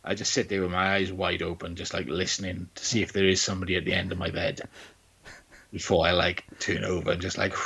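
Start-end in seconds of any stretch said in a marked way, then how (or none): none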